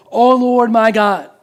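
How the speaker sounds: loud, drawn-out plea to God; crying out in prayer. Retold or imitated. Elijah prays over the widow's dead son, crying out. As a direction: cry it out slowly, high and loud. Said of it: "he cried to the Lord".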